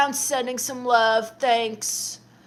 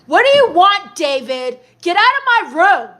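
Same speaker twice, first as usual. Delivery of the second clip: higher, louder shout at the stepdad to get out; angry shout; 6 semitones higher, 7 dB louder than usual. Real, not acted. Teen yelling at her stepdad David to leave her room, angry shout. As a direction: snap at the intruder, full volume.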